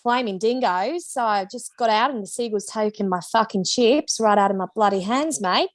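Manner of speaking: hammed-up Aussie accent; Australian accent. Shown as a demonstration. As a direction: play a stock Australian with broad Aussie vowels. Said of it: "Let's go to Australia".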